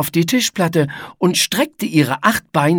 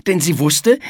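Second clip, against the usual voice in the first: usual speaking voice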